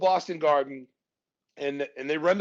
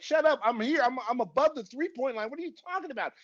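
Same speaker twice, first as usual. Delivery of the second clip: high-pitched indignant protest; acting out an exasperated player; waving someone off; 7 semitones higher than usual. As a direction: brush off the coach in disbelief. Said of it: "I'm waving Phil off like".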